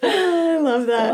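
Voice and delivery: high, slow words through real laughter; laughing. Real, not acted. Host delighted by guest's story, laughing. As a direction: say it while cracking up.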